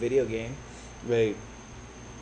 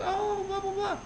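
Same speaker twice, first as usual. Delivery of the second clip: put-on girly voice; 7 dB quieter than usual. Mimicking hot girl at bar.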